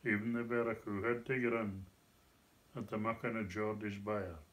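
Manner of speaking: Doric recited in broad Scots. Shown as a demonstration. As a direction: recite the verse in thick Doric. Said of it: "Doric Scots".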